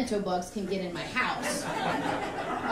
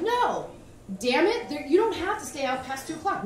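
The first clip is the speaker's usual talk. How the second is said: higher, louder insisting; insistent mom; 6 semitones higher, 4 dB louder than usual. Retold or imitated. Mom insisting to daughter about curfew, loud.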